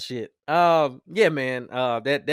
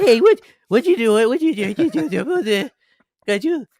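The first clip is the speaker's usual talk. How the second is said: a mocking imitation of an adult baby-talking a child, a touch higher; 3 semitones higher than usual. Mocking a woman who baby-talks her kid, in baby talk. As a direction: ham up a mother cooing at a toddler. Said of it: "when I hear a chick talking to a kid".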